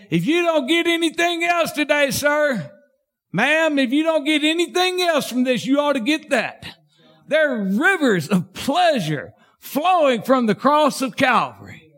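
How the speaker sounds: high, ringing preacher's cry; full-force sermon climax; fervent preaching. Real, not acted. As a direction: lift the church up with it.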